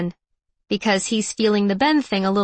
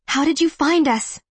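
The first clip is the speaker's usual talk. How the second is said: higher-pitched, wondering question; amazed question; 5 semitones higher than usual. A narrator's reading. She asks Evan how he found them, amazed. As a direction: ask it with lifted, marvelling voice.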